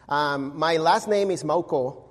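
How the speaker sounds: ordinary talk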